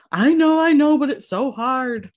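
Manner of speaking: high, loud, tearful mock sob voice; imitating crying sister. Sister-in-law pleading through tears, high mock crying. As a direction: mimic a sister who is crying and can't stop. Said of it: "she's bawling, she's crying".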